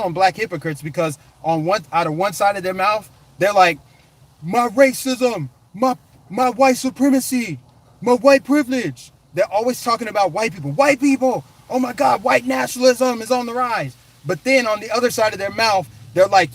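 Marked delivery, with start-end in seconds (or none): 4.4-9.1 high-pitched, drawn-out mock complaint; mocking whine. 10.7-13.9 high, loud mocking panic; mock alarm.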